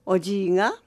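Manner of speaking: plain reading voice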